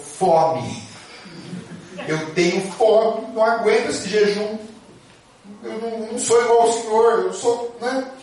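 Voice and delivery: put-on a starving young monk close to tears; pleading hungry monk. Hungry monk confesses crying.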